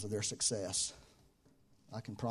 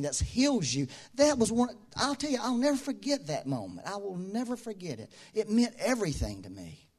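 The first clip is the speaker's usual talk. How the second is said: higher, impassioned sermon build; preaching at full force; 8 semitones higher, 4 dB louder than usual. Acted. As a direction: preach it out to the congregation with fire.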